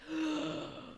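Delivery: sharp high gasp of shock; horrified gasp. Retold or imitated. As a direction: gasp in horror. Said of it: "And I'd be like".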